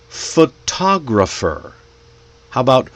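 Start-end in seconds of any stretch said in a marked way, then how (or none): 0.1-1.7 "Photographer" slowly, stressed on TOG; second-syllable stress. Shown as a demonstration. Teacher shows learners word stress, pho-TOG-rapher. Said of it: "we put the stress in different places".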